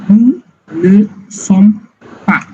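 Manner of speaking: each word drawn out slowly for the class; teacher dictating slowly to the class; slow dictation. Shown as a demonstration. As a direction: spell it out slowly so students can write it. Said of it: "dictating the negative form".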